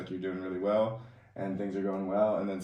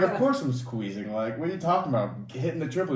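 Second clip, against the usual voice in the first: indignant comeback, higher and louder, half laughing; mock indignant retort; 5 semitones higher, 4 dB louder than usual. Athlete retelling his comeback to coach about squeezing, mock indignant. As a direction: retell your own protest with amused exasperation.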